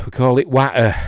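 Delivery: "watter" with a short flat A, loud; Yorkshire watter. Shown as a demonstration. Yorkshire vlogger showing how they say "watter". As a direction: say "watter" the Yorkshire way, clipped T kept. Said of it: "that's like what we say in Yorkshire".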